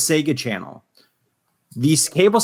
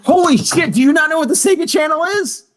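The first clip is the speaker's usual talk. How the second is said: high, loud, shocked outburst; can't believe they don't know; loud disbelief; 13 semitones higher, 4 dB louder than usual. Real, not acted. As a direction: burst out in incredulous shock, rushing the question. Shocked that co-host doesn't know it.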